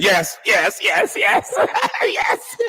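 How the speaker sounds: very high squeaky mimicry; mocking high-pitched yeses, breaking into laughter; mock eager interrupting. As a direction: parody an overeager yes-woman in falsetto. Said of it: "don't even let the lady finish what she said".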